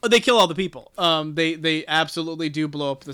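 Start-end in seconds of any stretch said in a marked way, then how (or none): 0.0-0.9 loud, high jolt of surprise on the news; louder and higher, a real gasp of shock; surprised. Real, not acted. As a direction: blurt it out, caught off guard. Reacting to a shocking plot reveal.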